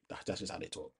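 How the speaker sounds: whispered under the breath; sheepish whisper. Real, not acted. Whispering it again after apologising to listeners. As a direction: drop to a guilty whisper. Repeats it after saying sorry.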